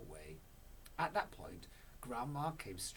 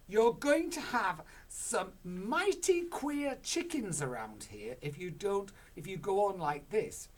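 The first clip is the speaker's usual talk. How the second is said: Missus Cranky warning; 10 semitones higher, 11 dB louder than usual. Acted. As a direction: draw out the doom. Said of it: "she said".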